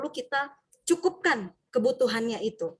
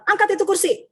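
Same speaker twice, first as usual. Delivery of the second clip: much higher, louder punishing command; barked order; 9 semitones higher, 4 dB louder than usual. Retold or imitated. Punisher teacher ordering a pupil, loud command. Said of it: "Sebagai penghukum".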